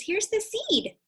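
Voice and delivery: high, happy exclaim; happy character. Acted. Character shows the seed, high happy voice. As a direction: show him relieved and pleased. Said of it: "he says".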